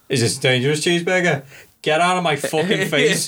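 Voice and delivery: a hint of a snappy dispatcher; lightly voiced fed-up operator; mock rude operator. Friend mocking the operator's tone, mild rude voice. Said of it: "notice the difference in the tone of voice".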